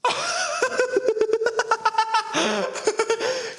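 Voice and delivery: cracking up hard; big laugh. Real, not acted. Fou rire après le défi. (grand éclat de rire)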